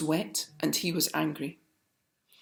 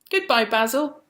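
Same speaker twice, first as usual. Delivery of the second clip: calling goodbye; 7 semitones higher, 6 dB louder than usual. A narrator's reading. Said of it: "called all the people".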